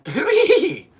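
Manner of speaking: very high, very loud cheer; hooray shrieked at the top of the voice; high shrill hooray. Shown as a demonstration. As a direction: squeal it like a cartoon crowd. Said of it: "You really gotta get ... the hooray!".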